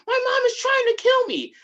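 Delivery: high, loud cry for help; mock frantic scream. Son runs into somebody's house, hammed-up panicked yell. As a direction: imitate a terrified kid raising the alarm.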